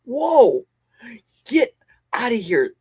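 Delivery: ordinary talk, nothing marked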